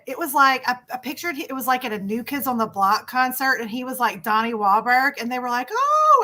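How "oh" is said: high squealing fangirl "Oh"; mock fan squeal. Retelling fans meeting the author, high mock squeal. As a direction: squeal it like a screaming teen fan.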